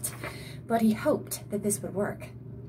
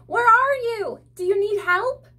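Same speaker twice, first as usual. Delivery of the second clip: high, loud calls for help; Audrey shouting to find the trapped friend; screaming; 12 semitones higher, 7 dB louder than usual. A narrator's reading. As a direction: yell into the dark hallway. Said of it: "Audrey screamed".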